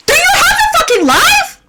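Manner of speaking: high, loud incredulous shout; exasperated yell. Real, not acted. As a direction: yell it in disbelief. Ranting about annoying classmate.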